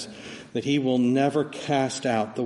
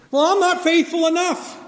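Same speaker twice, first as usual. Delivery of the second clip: much higher, loud despairing voice; anxious believer; 7 dB louder than usual. Retold or imitated. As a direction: voice the believer who feels unworthy. Voicing believers' self-doubt.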